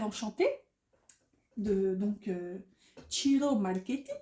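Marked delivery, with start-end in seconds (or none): 3.1-4.1 name said the Italian way, with an Italian accent. Real, not acted. Tarot reader names the deck's artist in an Italian accent. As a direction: Ciro Marchetti with a light Italian accent, the C like "tch". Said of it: "j'avais l'accent, euh, italien, il paraît".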